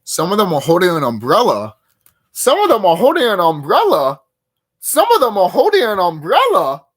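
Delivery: loud, incredulous repeated question; mock disbelief. Reacting to the umbrella detail, loud mock disbelief. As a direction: ham up the shock each time.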